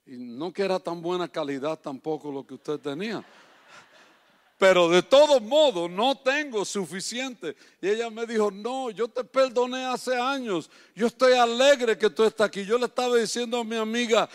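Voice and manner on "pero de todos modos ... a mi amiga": loud, ringing preacher's voice, driving each phrase home; preaching at full force. Acted. Preacher urging the congregation to open their hearts, loud and fervent. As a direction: preach it hard to the whole congregation.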